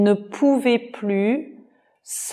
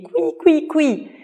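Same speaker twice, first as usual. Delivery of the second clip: higher squeaking like a mouse; 8 semitones higher than usual. A narrator's reading. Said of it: "Jorik a crié". (couinement de souris aigu)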